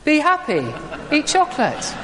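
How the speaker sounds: very high, blithe slogan; chirpy Easter Bunny answer. Acted. Preacher voicing the Easter Bunny's answer to someone in pain, chirpy. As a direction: chirp the pat refrain way up high.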